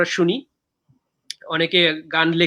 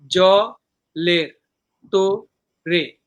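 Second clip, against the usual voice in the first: "জলের তোরে" very slow, broken into জ, লের, তো, রে; syllable by syllable; 3 dB louder, much slower than usual. Shown as a demonstration. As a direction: pause after each syllable of "জলের তোরে". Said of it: "এই হলো চারটা সিলেবল".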